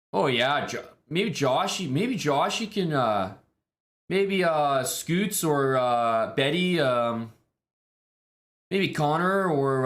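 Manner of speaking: put-on lazy Canadian hockey-bro accent, leaning back, words dribbling out; stoned hockey bro. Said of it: "a Canadian accent mixed with a little bit of marijuana".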